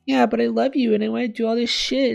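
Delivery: high, loud mock-lovey whine; mocking imitation. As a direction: parody a selfish girlfriend's excuse. Mocks selfish character's excuse.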